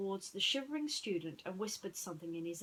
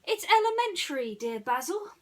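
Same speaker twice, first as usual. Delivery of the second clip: high, loud teasing reply; smug Dawson; 10 semitones higher, 9 dB louder than usual. Acted. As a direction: tease the great detective. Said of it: "Dawson replied".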